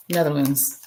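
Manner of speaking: ordinary talk, nothing marked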